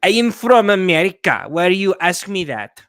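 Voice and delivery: put-on loud, indignant, thickly accented English; angry thick accent. Someone asked where they are from, angry thick accent. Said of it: "thick accent get angry".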